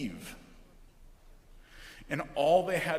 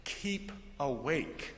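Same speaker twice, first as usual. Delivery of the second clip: quiet slow quote; 3 semitones lower, 8 dB quieter, slower than usual. Shown as a demonstration. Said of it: "Instead, it's".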